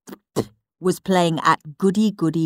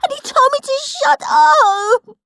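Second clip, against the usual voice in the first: much higher, tearful-sounding complaint; wailing; 5 dB louder than usual. Acted. Peter tattling to Mum, wailing. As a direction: whine it to Mum. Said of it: "wailed Peter".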